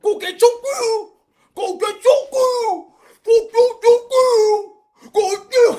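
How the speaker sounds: loud, high, slow hooting vowels; drawn-out mock deaf yell; imitated deaf man. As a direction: mimic deaf speech with blurred consonants, shouting.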